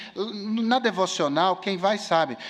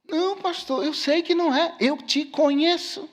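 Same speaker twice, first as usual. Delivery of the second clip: student's reply; 7 semitones higher than usual. Retold or imitated. Voices student's reply to the correction.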